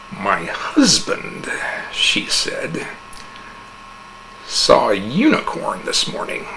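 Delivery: soft, slow conspiratorial telling; hushed gloating. A narrator's reading. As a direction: lean in and tell the secret. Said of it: "She was very excited".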